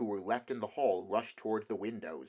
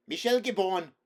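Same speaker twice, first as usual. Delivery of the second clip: high, loud irritated shout; irritated cry; 10 semitones higher, 9 dB louder than usual. A narrator's reading. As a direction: yell the actor's name in anger. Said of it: "cried the irritated poet".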